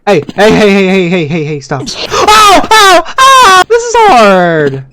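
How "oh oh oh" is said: high, loud yelps under attack; pained yelps. Real, not acted. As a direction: cry out with each hit. Being hurt in the game.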